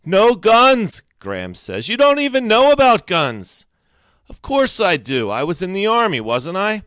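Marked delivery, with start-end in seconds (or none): 0.1-1.0 high, loud ban; high-pitched, forceful outburst; angry yell. A narrator's reading. Graham keeps yelling in quarrel. 1.9-3.7 high, loud accusation; high-pitched quarrelling shout; angry yell. A narrator's reading. Graham keeps yelling in quarrel. 4.3-6.8 loud, higher-pitched comeback; raised-voice indignant defence; indignant retort. A narrator's reading. Grim retorts in the quarrel.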